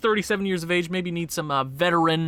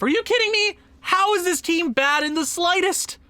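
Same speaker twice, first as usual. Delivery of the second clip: higher, disbelieving, are you serious; can't believe this team is bad; incredulous outburst; 10 semitones higher than usual. Real, not acted. Gamer to his viewers about his losing team, incredulous.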